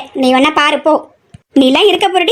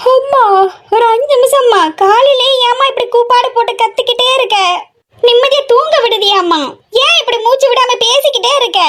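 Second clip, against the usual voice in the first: high, whiny sleepy daughter pleading; 6 semitones higher than usual. Acted. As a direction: beg mother for five more minutes. Daughter role, sleepy complaint. (தூக்கக் கலக்கத்தில் சிணுங்கும் மகள்)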